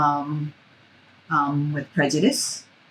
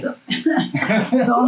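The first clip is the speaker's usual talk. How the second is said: laughing through a slow start to the question; 7 semitones higher than usual. Real, not acted. Interviewer laughing as she starts the next question. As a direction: ask while still laughing.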